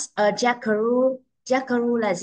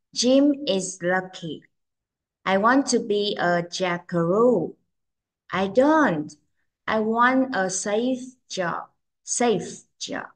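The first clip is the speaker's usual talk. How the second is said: slow, careful model reading; slower than usual. Shown as a demonstration. Teacher reads the fourth picture for children, very slowly. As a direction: read slowly, spacing the words for learners. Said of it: "Cô sẽ đọc rất là chậm".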